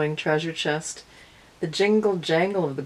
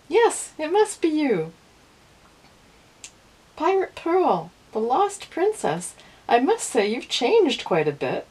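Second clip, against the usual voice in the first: much higher, eager princely voice; a prince thrilled to find her; excited prince; 9 semitones higher, 3 dB louder than usual. A narrator's reading. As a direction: burst out with delighted recognition. Prince recognises the lost princess.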